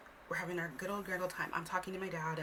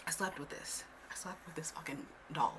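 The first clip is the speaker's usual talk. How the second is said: hushed confession, breathy and unvoiced; whisper; 2 semitones lower, 5 dB quieter than usual. Real, not acted. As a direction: whisper it like a guilty secret. Confessing childhood attachment.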